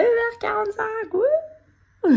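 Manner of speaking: high, loud ironic whoop; sarcastic cheer. Pretend. Mock-cheer about early start.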